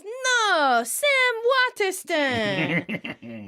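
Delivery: put-on a high, slow old Italian-accented insistence. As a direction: stretch out the Italian vowels on Sam Waterston. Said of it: "with her accent".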